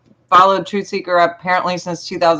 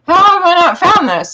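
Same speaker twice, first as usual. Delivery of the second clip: high, stunned squeal of surprise; amazed disbelief; 11 semitones higher, 3 dB louder than usual. Retold or imitated. Host recalling discovering the guest's music, shocked delight. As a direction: jump way up in pitch, floored by the discovery. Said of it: "I was like".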